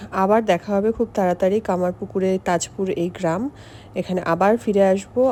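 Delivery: ordinary delivery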